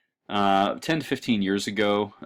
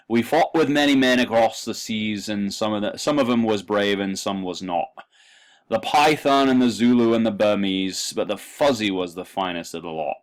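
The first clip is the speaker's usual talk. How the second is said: a passable Cockney accent, read a bit louder; 3 semitones higher, 6 dB louder than usual. Shown as a demonstration. Lecturer reading Kipling's poem to students in a Cockney accent. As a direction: recite the poem as a Cockney soldier would. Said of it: "I actually do a passable Cockney here".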